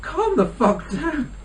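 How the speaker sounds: high, slow mock exasperation; exasperated retort. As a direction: stretch it out high, in comic disbelief. Acted exasperated reaction to cinema fight.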